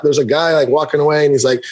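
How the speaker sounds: ordinary delivery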